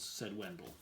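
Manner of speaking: ordinary delivery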